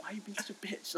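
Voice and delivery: hushed scolding whisper. Retold or imitated. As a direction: hiss it under your breath to a friend.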